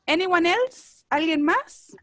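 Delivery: ordinary talk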